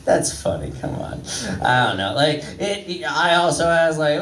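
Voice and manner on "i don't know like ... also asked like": normal talking voice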